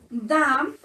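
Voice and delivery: "dam" said alone and firm; stressed syllable alone. Shown as a demonstration. Teacher isolating the stressed syllable of damage. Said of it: "this is where the sound of the word goes".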